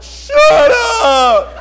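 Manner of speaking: put-on high, loud yell of a sulky kid; furious kid's "shut up", half laughing; retold angry yell. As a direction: scream it back at him. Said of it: "And I was like".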